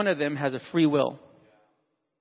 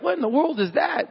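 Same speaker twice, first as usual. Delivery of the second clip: high, laughing what-was-that; startled by a noise; 8 semitones higher than usual. Retold or imitated. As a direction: jump at a noise outside with a laugh. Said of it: "you're like".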